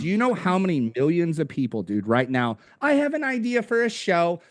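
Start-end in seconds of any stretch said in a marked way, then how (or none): 2.8-4.4 higher-pitched, imitating an eager dreamer; mock eagerness. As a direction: announce a big idea in mock excitement. Mimics eager would-be podcasters.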